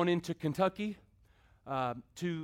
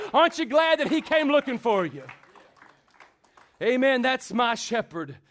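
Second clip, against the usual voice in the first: loud, high, rousing sermon delivery; full-force preaching; 6 semitones higher, 10 dB louder than usual. Acted. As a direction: preach it out to the whole church, building.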